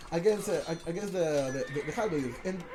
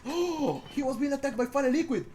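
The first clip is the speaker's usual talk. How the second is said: sharp gasp, then higher; surprised gasp; 6 semitones higher than usual. Real, not acted. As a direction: caught off guard mid-commentary. Sudden enemy attack noticed.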